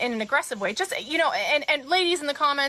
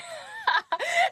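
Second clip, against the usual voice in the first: high, bursting real laugh; cracking up for real; real burst of laughter. Real, not acted. Bill's reply cracks her up.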